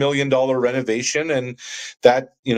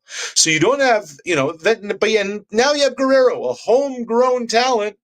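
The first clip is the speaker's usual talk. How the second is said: much higher, impassioned sports rant; passionate; 9 semitones higher than usual. Real, not acted. Frustrated Blue Jays fan ranting to the host, passionate. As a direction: argue it with real passion, pitched high. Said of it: "the passion in your voice".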